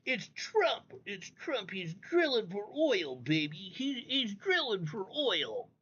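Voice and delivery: high, slow sarcastic parody of newscasters; mocking the news. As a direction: ham up their talking points in a high mock voice. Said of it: "It's like".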